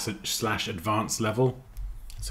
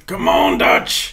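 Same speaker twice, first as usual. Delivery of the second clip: a lightly done gruff American cowboy growl, louder; Arthur Morgan impression; 4 semitones lower, 10 dB louder, much slower than usual. A streamer imitating Red Dead's Arthur Morgan, a light American cowboy bark. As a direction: lower American drawl barking at his gang. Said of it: "my Red Dead Arthur Morgan".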